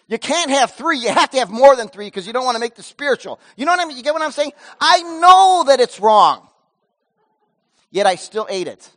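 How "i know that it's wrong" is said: loud, slow confession of wrong; slow and loud, owning the wrong; preached confession. Acted. Preacher to congregation, loud slow confession.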